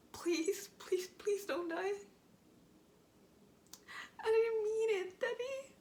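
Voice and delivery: broken, crying pleas; tearful pleading. Acted. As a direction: beg him not to die. Monologue collapses into remorse.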